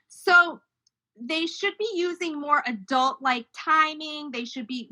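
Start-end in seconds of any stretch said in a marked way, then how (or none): none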